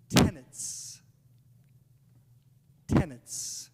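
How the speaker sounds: Tenets with every consonant crisp and exaggerated; over enunciating. Shown as a demonstration. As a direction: mouth out Tenets, each sound distinct. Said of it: "so you can hear it".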